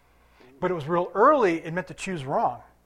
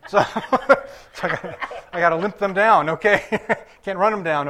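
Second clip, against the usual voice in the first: joking through a laugh, louder; 5 dB louder than usual. Real, not acted. Preacher joking about his limp after surgery, laughing. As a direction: let chuckles break into the words.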